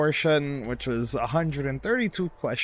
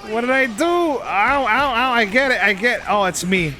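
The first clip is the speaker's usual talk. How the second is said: mock pain cries; 7 semitones higher, 5 dB louder than usual. Mock hurt by numpad shortcut.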